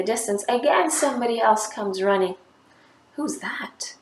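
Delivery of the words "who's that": breathless storyteller whisper. A narrator's reading. Teacher whispering the suspense to children. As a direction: hush the kids as someone comes running. Dramatic story moment, who is coming.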